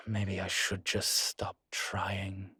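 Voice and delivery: soft, slow and defeated; resigned despair. Acted. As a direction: trail away slowly as if giving up. Giving up after self-blame.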